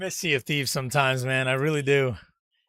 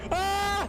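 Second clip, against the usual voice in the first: scream; 5 dB quieter than usual. Real, not acted. Chased in game, screaming.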